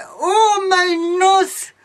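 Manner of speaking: high pained cry of disgust. Retold or imitated. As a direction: yelp as if the smell hurts. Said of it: "And I was like".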